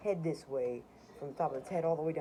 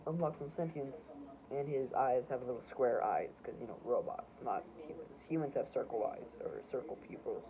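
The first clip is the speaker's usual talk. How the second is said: usual speaking voice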